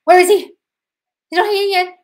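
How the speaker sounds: loud, high, frantic mock panic; shrill over-the-top mimic of a worried viewer; mock anxious whine. Host mocking a viewer checking for her ex, loud and frantic. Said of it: "You're like".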